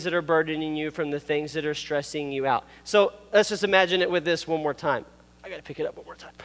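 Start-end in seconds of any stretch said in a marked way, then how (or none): 5.4-6.3 straining under a heavy backpack; words pushed out while hoisting a load; strained lifting. Real, not acted. Youth leader lifting the heavy backpack prop, strained. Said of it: "That's a heavy backpack, y'all".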